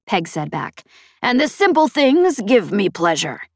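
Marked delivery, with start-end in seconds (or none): 1.2-3.4 high, brash alien matriarch; a proud, teasing pirate boss. A narrator's reading. Voiced alien pirate leader Peg.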